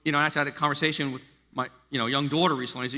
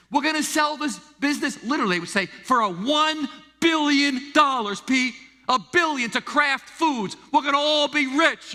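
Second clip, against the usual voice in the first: imitating boastful uncles; 9 semitones higher, 4 dB louder than usual. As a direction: brag like the uncles. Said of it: "my uncles would say it".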